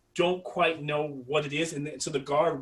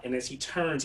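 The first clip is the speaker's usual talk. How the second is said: usual speaking voice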